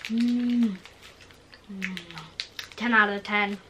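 talking while chewing; speaking around food; mouth full, chewing. Real, not acted. Seen 0: Left girl bites snack just before, jaw working while saying rating.

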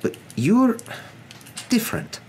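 high, halting praise; half exasperated, half admiring. Acted. Professor says the wizard is different, exasperated but admiring. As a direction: pitch it up, pausing before the compliment. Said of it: "sounding half exasperated, half admiring".

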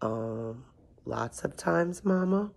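soft, hesitant, meek whimper. A narrator's reading. Giant answers his scolding mama, whimpering. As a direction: start almost inaudible, then softly edge upward. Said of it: "whimpered the giant".

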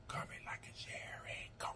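soft ASMR whisper. Shown as a demonstration. Whispering to viewers as her ASMR voice. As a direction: whisper the sign-off into the mic. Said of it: "as long as I say".

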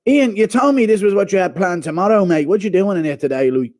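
loud indignant impression; Conor. Fighter walking in on a betrayal, loud accusing questions. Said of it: "Impression of Connor walking in on Ian Garry".

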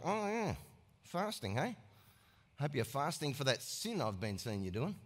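low, quiet, sneering aside; snarky under the breath. Acted. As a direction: mutter a catty jab. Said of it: "snarky comment under their breath".

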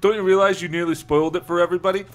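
loud, fast, high reproach; acted exasperated outburst; angry scolding. A narrator's reading. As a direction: rattle it off exasperatedly.

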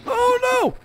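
loud, very high yelp of dismay; alarmed cry. Real, not acted. Player in danger in the game, alarmed.

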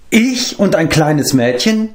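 high, slow, affronted question; indignant. A narrator's reading. Eilonwy objects to being called little, indignant. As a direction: throw the insult back as an outraged question. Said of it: "schüttelte zornig den Kopf".

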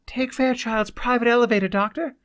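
high, carrying interruption; girl's clear voice. A narrator's reading. The girl directs Cloud to the elevator, clear voice. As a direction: break in clearly and urgently. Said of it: "the girl's clear voice broke in".

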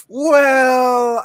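loud, high exclaimed well; mock shout. Casting team answering the accent question, mock shout. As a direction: burst out with it. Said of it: "They're like".

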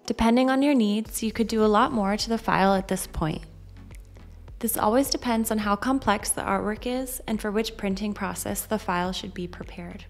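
normal talking voice

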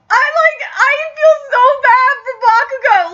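high, loud, near tears; tearful. Real, not acted. Fan pitying Bakugo, near tears. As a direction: choke up for him. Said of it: "I'm trying not to cry".

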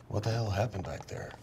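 soft, low, sober voice; hushed character question. Acted. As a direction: ask it quietly after a long silence. Gunthar asks after a silence.

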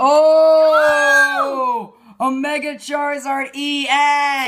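high, loud, slow gasp of joy; excited shout. Real, not acted. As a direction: shout it out in disbelief. Pulls a Mega Charizard.

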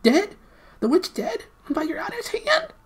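very high squeaky disbelief; astonished gnome. A narrator's reading. Gnome hears the witch is dead, astonished. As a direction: squeak in amazement like a little creature. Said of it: "wider and wider in astonishment".

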